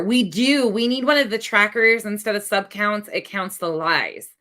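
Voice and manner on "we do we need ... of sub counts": ordinary delivery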